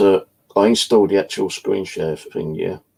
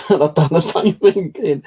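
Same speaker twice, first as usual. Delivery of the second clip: laughing through the words, voice jumping much higher; 9 semitones higher than usual. Real, not acted. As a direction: chuckle it out, amused at himself. Laughing while retelling the call-back.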